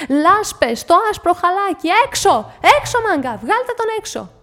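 high, loud furious outburst; έξαλλη φωνή; beside herself. Acted. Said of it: "ήταν έξω φρενών".

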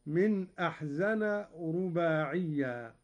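ordinary talk, nothing marked

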